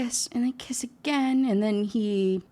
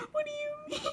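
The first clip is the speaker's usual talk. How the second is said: high, breathless laughing question; laughing disbelief; 9 dB quieter than usual. Real, not acted. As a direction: squeak it out through laughter.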